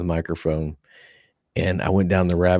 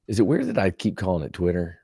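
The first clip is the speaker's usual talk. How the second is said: ordinary delivery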